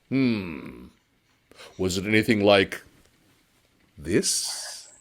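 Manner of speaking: noodle seller revealing he is the faceless ghost; sinister reveal. Acted.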